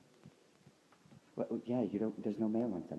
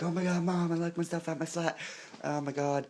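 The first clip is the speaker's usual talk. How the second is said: higher-pitched, rushed mock panic; retold panicked call; 5 semitones higher than usual. Telling Mom about being locked out, retold mock panic. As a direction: imitate yourself wailing to Mom about a lockout. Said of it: "be like".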